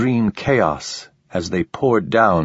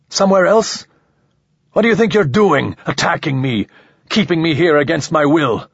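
angry tirade; 7 semitones higher than usual. Acted. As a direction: hurl the questions in rage. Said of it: "Ramon's rage ... Now it returned".

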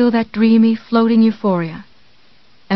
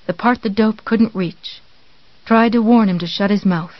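ordinary delivery

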